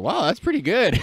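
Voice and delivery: high, loud impressed teacher. Retold or imitated. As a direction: gush like a delighted teacher. Teacher's praise imitated.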